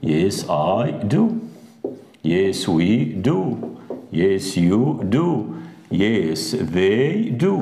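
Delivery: yes I do drawn out slowly; very slow model answers. Shown as a demonstration. Teacher modelling short answers for the class, very slow. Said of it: "هيبقى معاه ايه مثلًا".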